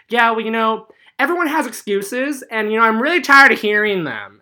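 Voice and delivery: loud, high, scornful teacher voice; mock angry teacher. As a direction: bark it out like a fed-up teacher. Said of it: "And he goes".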